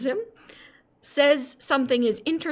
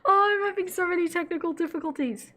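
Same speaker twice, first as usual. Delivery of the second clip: laughing; 7 semitones higher, faster than usual. Real, not acted. Laughing at her tech troubles.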